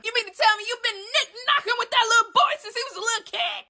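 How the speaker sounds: high anguished accusing yell; outraged cry. Real, not acted. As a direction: yell it in scandalized disgust. Outraged at Mercedes.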